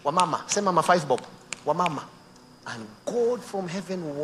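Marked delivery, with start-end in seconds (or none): 0.1-2.0 pushy salesman. Retold or imitated. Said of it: "they come and tell you".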